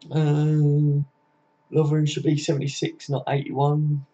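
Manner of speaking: ordinary delivery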